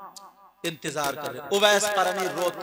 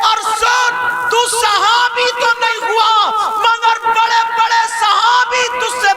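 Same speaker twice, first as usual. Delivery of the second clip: preaching at full force; 18 dB louder than usual. Acted. Preacher crying out the saint's story to the crowd, full force. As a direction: pour it out to the congregation.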